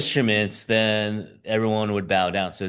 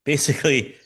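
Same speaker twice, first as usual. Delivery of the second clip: usual speaking voice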